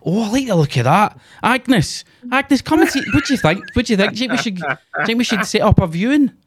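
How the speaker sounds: high-pitched, eager mock homebuyer; excited house-hunter. Pretend YouTube viewer calling Agnes to see a penthouse, mock excited. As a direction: call the partner over with hammed-up delight, speeding up at the end.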